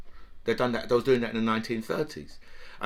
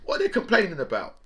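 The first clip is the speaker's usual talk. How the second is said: mock indignant worker, pitch jumping higher; mocking working-class voice; 10 semitones higher than usual. Comic imitating working classes dismissing complaints, mock indignant. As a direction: sneer it like a smug bloke down the pub. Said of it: "we working classes should look and say".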